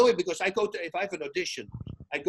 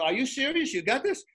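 higher, doubting questions; retold incredulity; 8 semitones higher than usual. Co-commentator doubts friend learned six pages, incredulous. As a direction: question it in mock astonishment. Retelling his doubt at quick memorizing.

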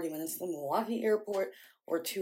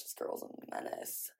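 whisper; 12 dB quieter than usual. Real, not acted. Muttering about sister, unvoiced.

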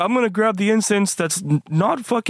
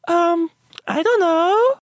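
character voice; 8 semitones higher, slower than usual. Acted. High slow character.

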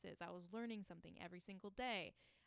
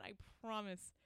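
usual speaking voice

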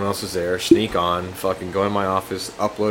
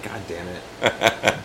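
dejected; 7 dB quieter, much slower than usual. Retold or imitated. Retold dejected reaction.

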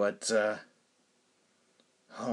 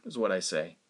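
ordinary talk, nothing marked

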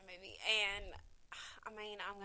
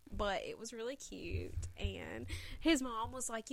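ordinary delivery

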